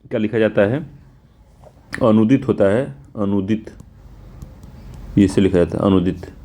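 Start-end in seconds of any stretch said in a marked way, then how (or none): none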